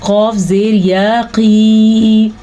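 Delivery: क़ी held long on a level tone; drawn-out madd. Shown as a demonstration. Teacher showing the ya madd, क़ी drawn out long. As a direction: spell it out, then stretch the vowel of क़ी. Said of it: "ये खींच के पढ़ेंगे".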